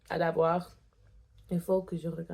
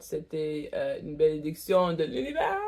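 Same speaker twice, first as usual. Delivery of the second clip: ordinary delivery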